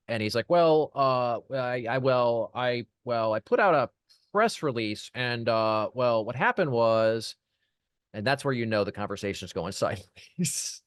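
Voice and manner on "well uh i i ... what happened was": mock flustered client, stop-start with drawn-out words; imitating stammering. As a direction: stumble over false starts, imitating someone caught out. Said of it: "he starts stuttering and stammering".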